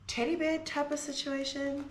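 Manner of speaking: ordinary talk, nothing marked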